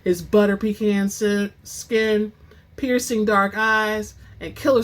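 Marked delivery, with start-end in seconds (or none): none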